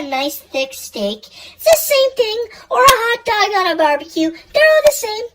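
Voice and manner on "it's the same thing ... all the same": high voice. Pretend. Sudden high put-on voice.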